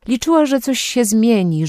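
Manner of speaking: plain reading voice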